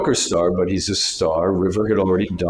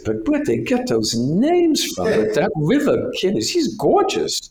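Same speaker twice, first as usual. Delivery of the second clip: higher, swooning gravelly New York voice; gushing New York agent; 13 semitones higher than usual. Retold or imitated. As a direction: swoon over the gorgeous kid. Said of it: "she talked like this with a gravelly voice from New York".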